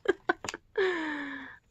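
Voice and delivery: high giggling laugh. Real, not acted. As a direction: laugh at your own attempt. Laughs at her ornament.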